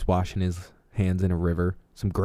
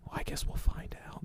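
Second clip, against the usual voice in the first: spooky whispered aside; 14 dB quieter than usual. Acted. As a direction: whisper it like a mystery teaser. Mysterious aside after hanging question.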